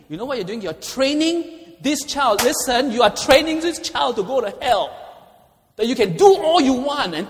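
high, loud preaching warning; forceful warning. Real, not acted. Preacher warning moms and dads, high and loud. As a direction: drive the warning home hard.

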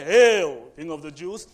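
loud mocking hail to a false king; mocking soldiers. Soldiers mocking Jesus, loud mock salute. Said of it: "the soldiers would say".

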